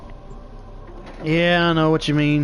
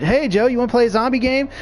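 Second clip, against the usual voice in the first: higher, chirpy mock invitation; eager pestering friend; 8 semitones higher, faster than usual. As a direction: bug your buddy over and over, higher. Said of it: "And he's like".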